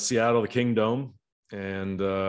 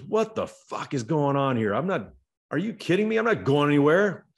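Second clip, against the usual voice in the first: retold yelling; 5 semitones higher than usual. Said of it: "he's, like, yelling at Benito Santiago".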